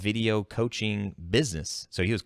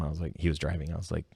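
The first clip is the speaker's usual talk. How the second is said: usual speaking voice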